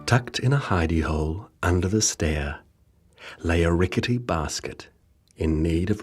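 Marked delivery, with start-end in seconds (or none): none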